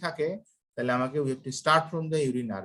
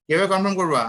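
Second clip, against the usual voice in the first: loud questioning; 6 semitones higher, 7 dB louder, faster than usual. Real, not acted. Repeats the question, class silent.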